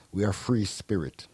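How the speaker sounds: ordinary talk, nothing marked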